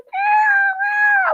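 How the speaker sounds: very high, loud shouted cat meows; imitated meowing. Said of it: "I said".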